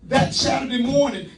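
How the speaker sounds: normal talking voice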